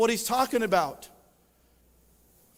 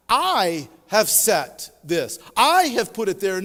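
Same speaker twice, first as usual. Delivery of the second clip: "I" punched loud and slow, then "I have put it there" louder still; stress on I; 6 dB louder, slower than usual. Shown as a demonstration. Preacher to congregation, hammering each "I", slow and loud. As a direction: lean hard on each "I" as God's own claim. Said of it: "the word "I" is emphatic".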